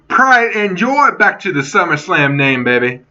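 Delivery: normal talking voice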